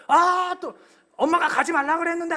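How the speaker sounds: put-on high, loud singsong child taunt; mock the boy like a schoolkid; taunting kids. Kids teasing the pastor's son at the crosswalk.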